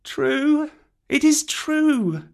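put-on very high, slow, mournful child's voice; Mowgli, sorrowful. A narrator's reading. Mowgli owns up to Bagheera, sorrowful and high. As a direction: admit it sadly in a small high voice. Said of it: "said Mowgli sorrowfully".

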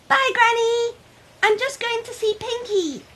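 put-on high bright child voice, eager; little girl voice. Child character speaking.

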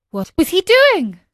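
high, loud, urgent question; eager questioning. A narrator's reading. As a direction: press anxiously for news. Pinocchio eager for news of father.

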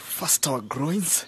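usual speaking voice

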